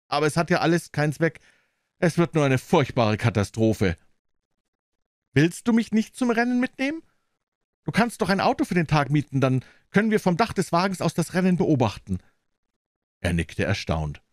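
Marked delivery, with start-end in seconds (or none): 5.3-12.2 deutlich höhere Frauenstimme, gespannt fragend; Marjorie, neugierig. Acted. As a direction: als junge Frau drängend nachfragen. Marjorie voiced, higher. (Marjorie fragt Jeans gespannt nach dem Besitzer, mit hoher Stimme)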